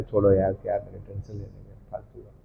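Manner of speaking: low, fading brush-off; dismissive refusal. Acted. Annoyed devotee refusing offered food, dismissive. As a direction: wave it away under your voice.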